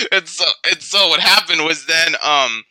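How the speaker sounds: normal talking voice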